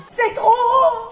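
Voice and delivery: slow, high fearful cry; scared whine. Acted. Nathan on the climbing wall crying to his mother, high and slow. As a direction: wail about the height.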